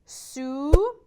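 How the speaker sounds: sue with a long, closed oo; closed vowel. Shown as a demonstration. Teacher giving sue as a closed-vowel example, oo drawn out. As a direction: draw out the rounded oo in sue. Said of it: "The first one was closed".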